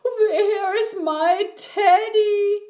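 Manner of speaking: high, loud, drawn-out bear whine; long plaintive moan in a big voice; big whining wail. A narrator's reading. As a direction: wail slowly for something lost. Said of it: "A great big voice whined".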